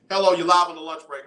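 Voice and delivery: loud, quick on-air greeting; radio host greeting. Real, not acted. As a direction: pick up the call like a DJ.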